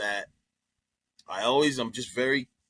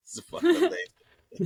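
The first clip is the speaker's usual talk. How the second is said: laughing. Real, not acted. Laughing at a fake name.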